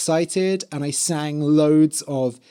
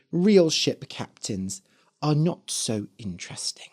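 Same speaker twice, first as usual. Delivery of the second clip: ordinary talk, nothing marked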